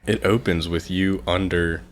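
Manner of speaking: ordinary talk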